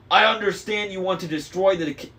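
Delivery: yelled. A narrator's reading. Annabeth yelling at Luke about the camp. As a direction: yell it at him. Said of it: "she yelled".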